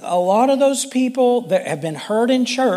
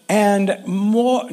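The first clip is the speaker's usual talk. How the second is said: ordinary delivery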